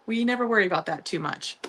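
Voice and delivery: ordinary talk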